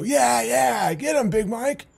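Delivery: high egging-on crowd voice; cheering fans. Retold or imitated. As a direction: cheer him on like a bloodthirsty fan. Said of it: "just to hear the people go".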